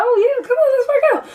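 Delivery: very high, laughing fake enthusiasm; hammed-up excitement, way up high with a laugh; mock eager. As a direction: squeal it like you're thrilled to go. Her own mock-eager reply.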